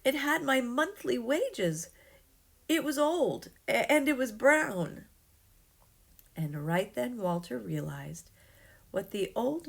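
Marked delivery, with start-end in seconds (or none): 0.0-5.1 put-on high, loud, rushed pleading old man; distressed old man. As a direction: beg desperately to get it back. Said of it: "said the old man with a frown".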